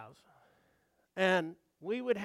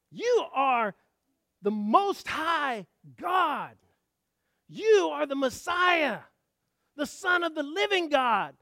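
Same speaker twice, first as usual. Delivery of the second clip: slow, ringing declaration; bold proclamation; 6 semitones higher, 7 dB louder, slower than usual. Acted. Peter on the mountaintop confessing the Messiah, bold proclamation. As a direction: proclaim it grandly, stretching the key words.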